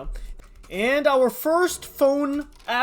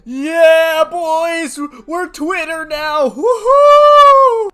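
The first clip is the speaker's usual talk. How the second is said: loud, high victory cheer; excited whoop; 7 semitones higher, 14 dB louder, much slower than usual. Real, not acted. Gamer cheering his boys after unlocking Twitter, loud whoop. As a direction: whoop with real glee.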